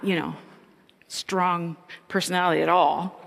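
usual speaking voice

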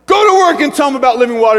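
hammed-up pep-talk order; mock rallying. Acted pastor rallying everyone.

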